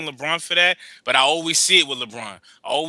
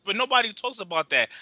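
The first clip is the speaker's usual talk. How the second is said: usual speaking voice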